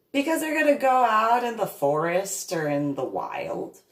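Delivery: high, loud exasperated retort; exasperated kid. Retold or imitated. Kid answering classmate's pressing questions, loud exasperation. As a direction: imitate a kid fed up with questions.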